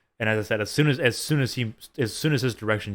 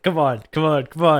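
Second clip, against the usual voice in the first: urging on; 6 semitones higher, 8 dB louder than usual. Retold or imitated. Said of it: "I was just like".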